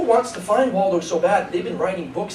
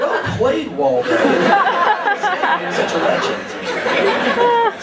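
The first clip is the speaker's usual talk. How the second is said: high, very slow laughing; stretched-out laughing tribute; laughing praise; 13 semitones higher, 6 dB louder, much slower than usual. Real, not acted. Laughing admiration for Waldo.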